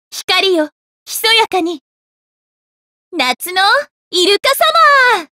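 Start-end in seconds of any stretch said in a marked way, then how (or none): 4.1-5.3 rising call with a long last vowel. Acted. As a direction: call out, stretching the final syllable. Calling out during an attack.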